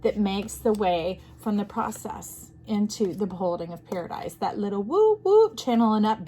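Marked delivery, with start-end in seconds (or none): 4.8-5.6 high, loud woo-woo noise; playful "woo woo". Shown as a demonstration. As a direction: whoop it high like a sci-fi effect. Imitates a little channeling whoosh.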